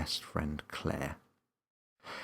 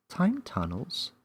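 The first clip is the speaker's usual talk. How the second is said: slow, higher question; curious Millie; 8 semitones higher, slower than usual. A narrator's reading. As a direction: wonder aloud. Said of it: "Millie inquired".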